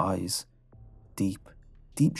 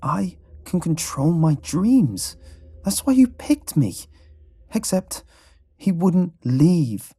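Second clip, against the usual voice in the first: high, loud, slowly spaced insistence; deliberate delivery with mounting distress; 9 semitones higher, 6 dB louder than usual. A narrator's reading. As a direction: insist word by word, voice raised and strained. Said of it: "slowly and deliberately".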